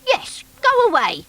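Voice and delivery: high, funny little creature voice; bunkie's voice. Acted. Bunkie telling Noddy about himself, high creature voice. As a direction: squeak it out like a hurt half-rabbit.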